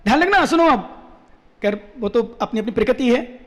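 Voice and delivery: ordinary talk, nothing marked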